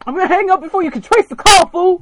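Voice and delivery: host panicking at the stalker ex, shouting; panicked yell. Pretend. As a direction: scream it at him in panic.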